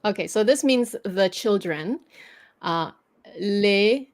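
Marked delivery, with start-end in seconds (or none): 3.4-4.1 "les" like "lay", S silent; French "les", open ending; word alone. Shown as a demonstration. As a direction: say "les" by itself without the S.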